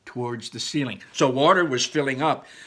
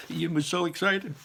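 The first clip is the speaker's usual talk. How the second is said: laughing while reading; 5 semitones higher than usual. Real, not acted. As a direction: laugh through the line. Laughing narration.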